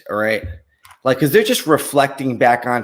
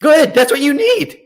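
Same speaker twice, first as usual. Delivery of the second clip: mock psychic, much higher, pushing good vibes; upbeat psychic; 10 semitones higher, 3 dB louder than usual. Psychic to client, selling positivity in a much higher mock-cheery voice. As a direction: lay on the cheerful psychic pep talk, pitched way up.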